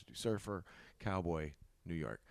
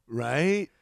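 hyped trainer; 6 semitones higher, 11 dB louder than usual. Acted. Trainer doubting, still pumping.

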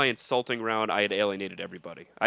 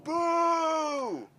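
long, very high mock boo; crowd booing; 12 semitones higher, 6 dB louder, much slower than usual. Party crowd jeering the comedian, long high booing. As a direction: imitate a hostile crowd's drawn-out jeer.

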